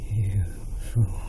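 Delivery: breathless, hushed queen's whisper. Acted. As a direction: hiss it under your breath. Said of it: "the queen finally whispered".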